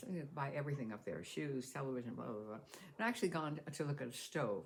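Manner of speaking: ordinary talk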